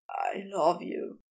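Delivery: low, quiet choked confession; a touch of tears in a low voice; choked, tearful. A narrator's reading. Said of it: "he choked out as he cried".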